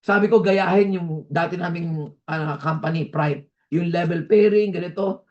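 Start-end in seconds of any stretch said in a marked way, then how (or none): none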